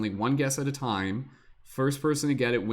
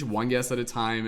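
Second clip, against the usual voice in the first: usual speaking voice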